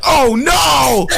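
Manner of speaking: high, loud drawn-out wail; hammed-up "oh no"; mock dismay. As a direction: cry out in cartoonish doom. Acting out character's dying cry.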